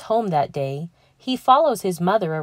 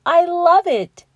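delighted; 12 semitones higher, 7 dB louder than usual. Acted. Mama delighted by the quilt.

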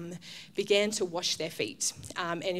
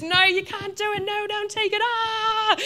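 high, louder hammed-up child's protest; mock whiny protest; 12 semitones higher, 4 dB louder than usual. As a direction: whine like a child refusing the clippers. Resisting kid voiced as us.

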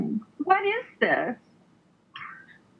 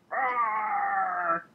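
mock alligator roar; 7 semitones lower than usual.

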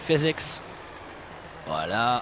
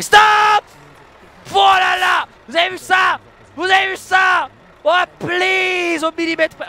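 excited boasting; 9 semitones higher, 6 dB louder than usual. Real, not acted. Proud of precise parking.

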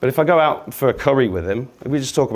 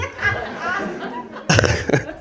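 high wheezy laugh; 5 dB quieter than usual. Real, not acted.

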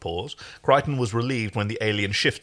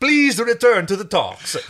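put-on Italian accent; 8 semitones higher, 7 dB louder than usual.

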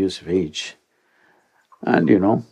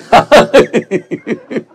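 real high laugh, louder; high burst of laughter; 13 semitones higher, 5 dB louder than usual. Real, not acted. Laughing at his young self's bold plan.

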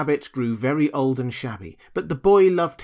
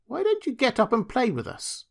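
high, lilting storybook rabbit voice; wild rabbit inviting; 11 semitones higher than usual. A narrator's reading. Furry rabbit asks toy rabbit to play, high playful invitation. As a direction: invite playfully in a much higher pitch.

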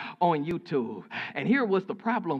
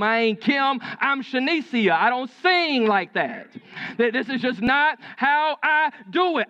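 raised-pitch, emphatic acted refusal; imitating an indignant, insistent comeback; heated retort; 5 semitones higher, 5 dB louder than usual. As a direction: retell her standing her ground in a heated exchange. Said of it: "She had a very heated conversation".

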